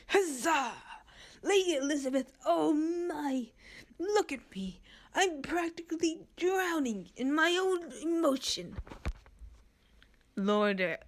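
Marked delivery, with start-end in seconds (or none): 0.1-8.8 emotional gushing. Acted. Theo overwhelmed.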